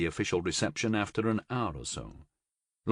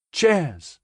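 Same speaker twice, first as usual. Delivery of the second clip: cheerful toast; 7 semitones higher, 4 dB louder than usual. A narrator's reading. Group toast.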